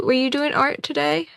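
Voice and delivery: normal talking voice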